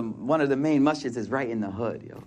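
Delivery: normal talking voice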